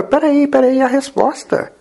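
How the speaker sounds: high-pitched, startled realization; sudden realization. Acted. Young man halting on the first step, sudden realization. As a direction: stop short as the answer hits.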